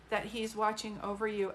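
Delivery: normal talking voice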